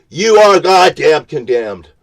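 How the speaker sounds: high, loud, hammering each word; a furious preacher's shout; shouted condemnation. Real, not acted. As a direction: thunder the judgment down. Shouted verdict, then repeated.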